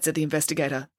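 ordinary delivery

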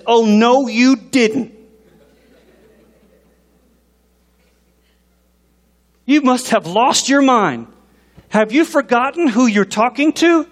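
high, sassy mock outrage; indignant scolding. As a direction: scold as an affronted God, hammed-up. Plays God scolding the people.

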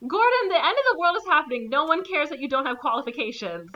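high, rapid mock rebuke; exasperated scolding. Viewer telling Gordon the world is ending, exasperated mock scolding. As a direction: scold the hero, voice jumping up. Speaker scolding the film character.

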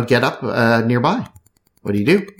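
ordinary talk, nothing marked

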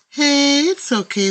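singsong comfort; sing-song. A narrator's reading. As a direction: sing it out to soothe a frightened friend. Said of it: "the jellyfish sings".

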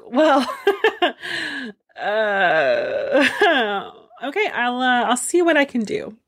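Loki stifling giggles mid-reply; mock-casual Loki trying not to laugh. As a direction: chuckle through the words, barely holding it in. Loki laughs off Thor's news.

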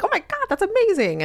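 very high-pitched imitated gush; squealing mock congratulations; super excited. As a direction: imitate a thrilled, supportive reaction way up high. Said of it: "all super supportive and excited".

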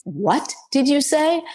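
a boss who can't believe what he heard; incredulous retort. Retold or imitated. Retold boss, stunned and incredulous.

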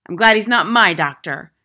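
loud, stern interrogation; domineering schoolmistress; tyrannical headmistress, demanding. A narrator's reading. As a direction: demand it sharply of the boy.

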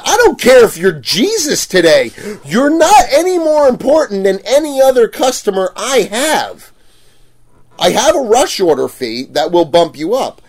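loud, high-pitched ranting comeback; exasperated retort. Retold or imitated. Booth owner telling off a demanding customer, exasperated. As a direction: tell the customer off, acted out at full tilt.

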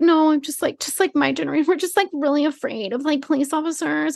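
hammed-up girly whimper; mock whiny girl. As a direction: mimic a simpering drunk girl. Mimicking drunk girl.

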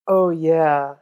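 usual speaking voice

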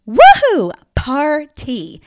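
loud, high-pitched whoop of celebration; big, whooping anniversary cheer; celebratory cheer. Real, not acted. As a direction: cheer it out like a party is starting. Celebrating the podcast turning two.

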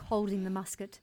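ordinary delivery, nothing marked

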